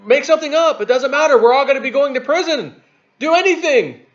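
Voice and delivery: high, loud mock panic from cornered officials; mock panicked politicians. Mocking Democrats scrambling to avoid prison, high and panicky. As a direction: squeal it like a crook who knows he's caught.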